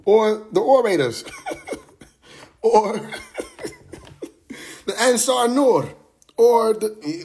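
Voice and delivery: high, laughing mock voice of clueless outsiders; hammed-up high voice guessing group names. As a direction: squeak out the names like a giggling know-it-all. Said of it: "They say".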